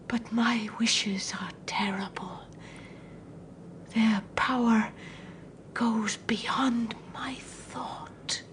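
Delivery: low, slow, dread-filled whisper; fearful whisper. Acted. Mother whispering her terrible secret to the visitor. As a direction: breathe it out as if afraid of your own words.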